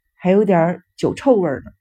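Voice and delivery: ordinary delivery, nothing marked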